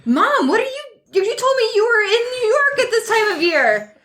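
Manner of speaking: put-on shrill-free high startled teen voice; high-pitched surprised daughter. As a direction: blurt it out high and shocked.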